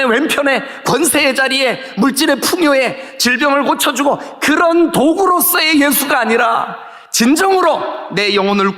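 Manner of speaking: high, driving sermon delivery; full-force preaching. Real, not acted. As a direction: build phrase by phrase into a fervent proclamation.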